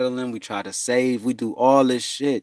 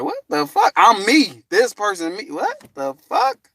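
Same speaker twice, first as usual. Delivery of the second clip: high, rising incredulous cry; can't believe what you hear; stunned disbelief; 8 semitones higher, 3 dB louder than usual. Retold or imitated. Man stunned at being called Enoch, incredulous.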